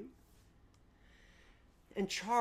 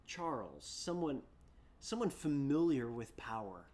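ordinary talk, nothing marked

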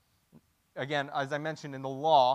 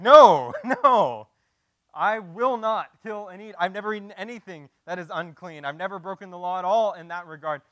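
horrified Peter; 4 semitones higher, 11 dB louder than usual. Acted. Peter horrified, refusing God.

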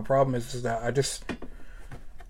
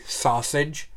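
ordinary talk, nothing marked

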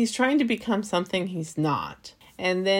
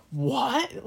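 confused what; 7 semitones higher than usual. Retold or imitated. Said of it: "so confused".

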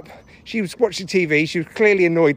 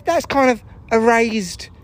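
ordinary delivery

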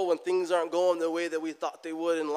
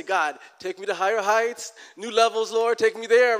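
louder, reaching, hammed-up prayer; mock eager prayer; 5 semitones higher, 10 dB louder than usual. Preacher mimics churchgoers praying for higher heights, loud mock plea. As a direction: pray like you want the top floor, loudly. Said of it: "Most of us come in the morning and say".

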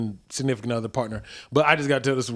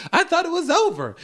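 put-on high girl voice. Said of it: "She's like".

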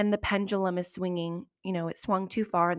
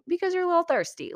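quick, much higher teasing aside; teasing jab; 11 semitones higher, faster than usual. Real, not acted. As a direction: toss it off with a knowing tease.

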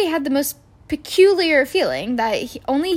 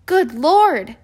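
surprised; 5 semitones higher, 5 dB louder than usual. A narrator's reading. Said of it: "said the bartender".

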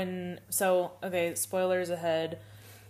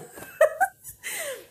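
high squeal laugh; 4 dB louder than usual. Real, not acted. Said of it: "laughs at teasing".

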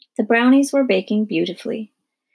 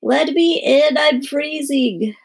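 put-on high, loud, drawn-out child's yell; 5 semitones higher, 4 dB louder, slower than usual. A narrator's reading. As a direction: wail to be let in, slowly.

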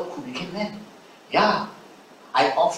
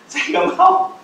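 whiny congregant; 13 semitones higher, 5 dB louder, slower than usual. Acted. Pastor voicing a reluctant congregant.

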